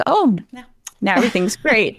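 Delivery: high, overly cheerful mock relief. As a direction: play the naive optimism, sing-song. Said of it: "It wasn't just like".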